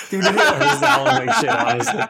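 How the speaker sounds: laughing, loud and high. Real, not acted. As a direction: laugh the words out. Agreeing with a joke, laughing.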